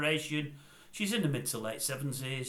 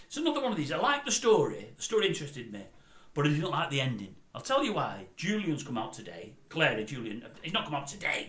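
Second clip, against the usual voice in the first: ordinary talk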